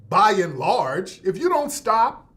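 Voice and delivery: ordinary delivery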